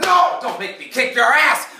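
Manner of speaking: high, loud mock menace; mock threat. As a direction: threaten her jokingly, high. Salesman threatens, retold comically.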